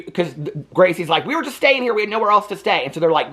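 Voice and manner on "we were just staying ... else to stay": higher, earnest plea; pleading girl. Retold or imitated. Gracie pleading with the police, higher and earnest. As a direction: explain desperately to the cops.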